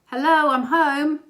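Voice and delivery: loud, high call up the stairs; shouting up. Retold or imitated. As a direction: call out a cheery arrival to the whole house. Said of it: "he shouts up to them".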